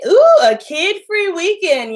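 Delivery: drawn-out, high, delighted ooh. Real, not acted. Vlogger to viewer, relishing a kid-free weekend, delighted. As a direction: savor the thought of a free weekend.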